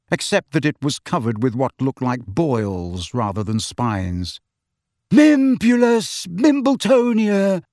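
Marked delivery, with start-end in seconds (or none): 5.1-7.6 proud. A narrator's reading. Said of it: "he said proudly".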